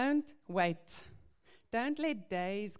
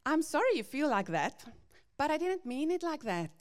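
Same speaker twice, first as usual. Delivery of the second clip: higher and louder, a mock defensive non-apology; 6 semitones higher, 4 dB louder, faster than usual. Parent excusing themselves to their child, mock defensive and higher. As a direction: whine it out, brushing off the hurt. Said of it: "But how does this sound?".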